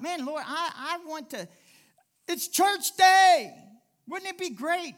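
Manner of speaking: high, loud, eager prayer; thrilled, pleading cry to the Lord; excited prayer. Retold or imitated. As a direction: burst with longing for church day.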